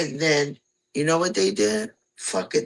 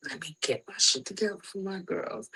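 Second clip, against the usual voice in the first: quiet, cracking, half-whispered resolve; softly breaking retold vow; voice about to crack; 8 dB quieter than usual. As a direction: say it to yourself, close to tears. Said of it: "My, my, my voice about to crack".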